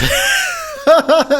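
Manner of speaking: high, loud burst of laughter. Real, not acted. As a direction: explode into a laugh.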